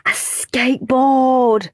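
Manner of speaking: slow drawn-out call; shouted. Acted. Al shouting guess at prize, slow call. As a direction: stretch the word out. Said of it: "shouted Aerobic Al".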